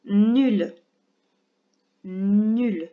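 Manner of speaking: syllabe dictée, bien détachée; voix de dictée. Shown as a demonstration. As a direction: articule comme pour une dictée à l'école. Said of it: "faire une petite dictée de syllabes". (la maîtresse dicte une syllabe à l'élève, bien articulée)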